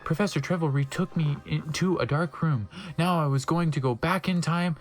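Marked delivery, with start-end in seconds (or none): none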